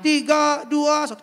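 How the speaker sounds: loud high countdown. Pretend. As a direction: count down hyped. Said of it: "countdown in balloon". (hitung mundur keras dan tinggi)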